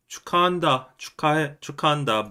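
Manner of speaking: 축하한다 clipped quick in Busan dialect; fast Busan congratulations. Shown as a demonstration. Busan guy rushing past a friend's wedding news, quick. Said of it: "but it'd be very fast".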